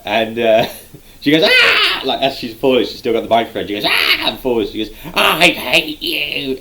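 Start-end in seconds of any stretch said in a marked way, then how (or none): 1.4-2.0 high, loud comic shriek; mock falling scream. Imitates wrestler falling. 3.9-4.3 high, loud short yelp; mock falling scream. Second falling scream imitated. 5.1-6.6 high, slow, drawn-out wail; mock furious wail. Falling wrestler's cry imitated.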